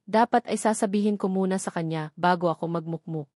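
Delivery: ordinary delivery, nothing marked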